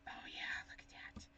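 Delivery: hushed, whispered delight; admiring whisper. Real, not acted. Crafter admiring the marks on the paper, whispering. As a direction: whisper it almost to yourself.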